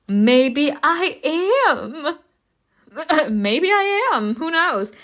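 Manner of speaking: high, drawn-out, teasing maybe; playful teasing. Real, not acted. Player answers the drowning question, playful high teasing.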